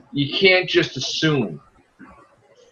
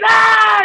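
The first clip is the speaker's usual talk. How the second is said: groan; 6 dB louder than usual. Retold or imitated. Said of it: "And they're like".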